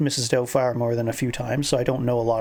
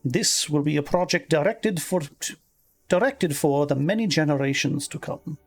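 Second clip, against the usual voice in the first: lilting, higher-pitched Scottish reading; Scottish accent; 3 semitones higher than usual. Acted. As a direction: read the article as a Scottish woman. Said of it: "I've been doing the Scottish accent".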